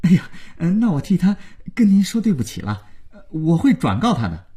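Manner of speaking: nervous, fumbling politeness; flustered. A narrator's reading. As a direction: placate the angry caller in a hurry. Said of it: "廖宇慌慌张张挂上电话".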